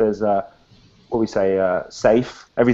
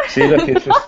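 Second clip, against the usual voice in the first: usual speaking voice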